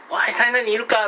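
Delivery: high, loud call for a missing person; searcher calling a name. Retold or imitated. How real searchers would call.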